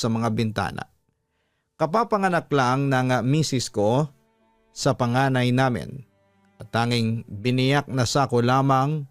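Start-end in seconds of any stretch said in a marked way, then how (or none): none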